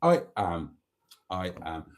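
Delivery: ordinary talk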